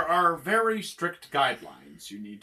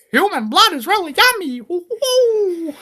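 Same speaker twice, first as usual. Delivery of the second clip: very high, loud falsetto glee; cartoonish delight; 6 dB louder than usual. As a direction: squeal with delight and whoop. Customer delights in tasting human blood.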